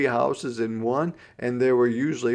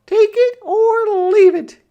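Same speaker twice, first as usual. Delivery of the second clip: put-on very high, loud little girl; little sister voice; 13 dB louder than usual. A narrator's reading. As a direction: squeak it like a small stubborn girl. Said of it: "she said".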